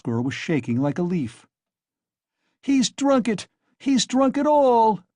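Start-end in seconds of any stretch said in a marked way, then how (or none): 2.6-5.0 very high, loud despairing scream. A narrator's reading. Wife discovers he drank it all, despairing scream. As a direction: scream it out, heartbroken. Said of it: "the poor woman screamed in despair".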